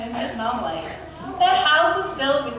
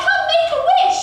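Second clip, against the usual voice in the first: very high, loud fairy call; fairy's high-pitched proclamation; crying out; 7 dB louder, faster than usual. A narrator's reading. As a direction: sing out the invitation high and loud. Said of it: "she cried".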